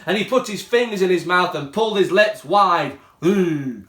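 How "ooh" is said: mild scared cry. Acted. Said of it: "screamed Ugly Mug".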